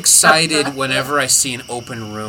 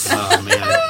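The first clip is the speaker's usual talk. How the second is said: high-pitched burst of laughter. Real, not acted. Laughing at Keith story.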